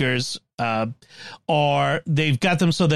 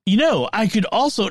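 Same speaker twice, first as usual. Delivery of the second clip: ordinary delivery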